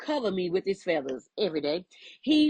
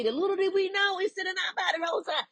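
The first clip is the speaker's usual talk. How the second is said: excited; 12 semitones higher, faster than usual. Real, not acted. Excited revelation, high pitch.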